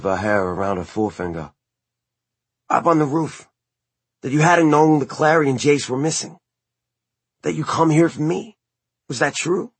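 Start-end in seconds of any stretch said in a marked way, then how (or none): 2.7-9.7 put-on higher, tentative young man; Simon voice. A narrator's reading. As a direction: raise the voice for Simon.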